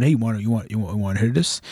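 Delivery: low, soft stoner voice offering a hit; hushed low bathroom offer. Retold or imitated. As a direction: mumble it like a stoner passing a joint. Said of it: "somebody went".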